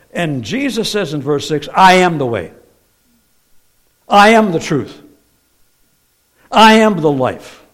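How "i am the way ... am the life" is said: loud, measured declaration, each phrase set apart; emphatic proclamation. Acted. Jesus telling Thomas who he is, loud emphatic proclamation. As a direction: declare it firmly, hitting the first word.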